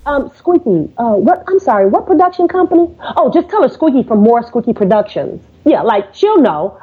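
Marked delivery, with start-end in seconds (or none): 0.0-2.9 unsure receptionist. Retold or imitated. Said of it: "now he's unsure". 3.2-5.4 casual, as if she'll know; breezy confidence. Retold or imitated. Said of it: "that was the inflection in my voice".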